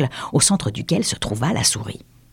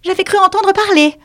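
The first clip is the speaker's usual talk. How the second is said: prim, high mouse; 4 dB louder than usual. Acted. The mouse to the lory, high and prim. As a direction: sniff it out primly.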